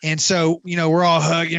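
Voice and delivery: normal talking voice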